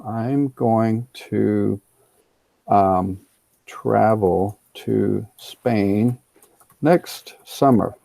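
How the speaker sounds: very slow, spelled-out teacher example; slow dictation pace; slow model sentence. Shown as a demonstration. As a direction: dictate each word slowly for the class. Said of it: "three different ways of saying future".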